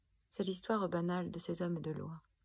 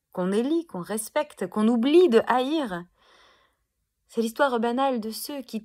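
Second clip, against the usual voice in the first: loud, raised slam crescendo; furious declamation; 3 semitones higher, 10 dB louder than usual. Acted. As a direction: drive it out loud in long angry runs. Poem's angry climax against honoured abusers. (déclamation forte et en colère)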